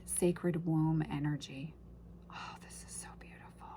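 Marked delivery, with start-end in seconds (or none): 2.3-3.7 breathless whisper of wonder; hushed, reverent whisper to herself; awed whisper. Real, not acted. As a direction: whisper it, overcome by beauty. Awed at the deck's images.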